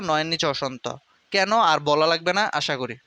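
normal talking voice

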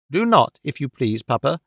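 ordinary delivery

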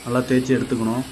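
ordinary talk, nothing marked

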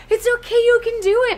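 high, quick little coaxing voice; urgent encouragement, reenacted. Friend helping him get the words out, high quick coaxing. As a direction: cheer him on fast and high.

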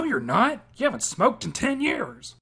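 ordinary talk, nothing marked

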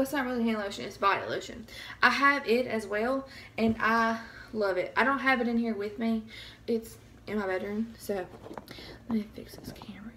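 ordinary delivery

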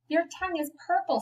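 high-pitched scream without the volume; mock scream. A narrator's reading. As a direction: play a child's scream, held back. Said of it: "screamed Fern".

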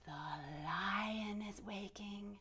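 hushed whisper, slow. Acted. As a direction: whisper it like the lion might hear. Kids yoga story, hushed reveal.

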